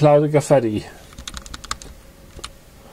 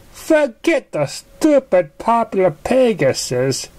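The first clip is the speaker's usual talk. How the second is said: high, slow excited pony exclaim; high-pitched cry of excitement; 11 semitones higher, slower than usual. Acted. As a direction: burst out in a high young voice. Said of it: "she exclaimed".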